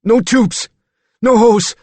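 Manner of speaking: high, loud terrified no; pained moan. A narrator's reading. Danny dreads the stomach pump, moaning in fear. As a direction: cry it out in dread. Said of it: "Danny moaned".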